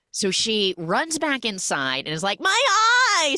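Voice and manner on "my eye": high, loud mock cry of pain; shrieking about her eye. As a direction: yelp it like a cartoon victim. Acted cry of pain in recap.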